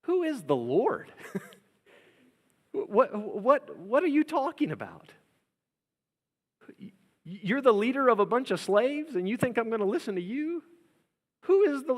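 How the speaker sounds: high, mocking king's voice; scornful Pharaoh. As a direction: sneer down at a slave leader. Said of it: "Pharaoh's response is".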